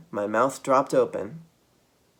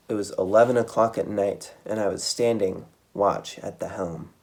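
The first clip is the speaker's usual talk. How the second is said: plain reading voice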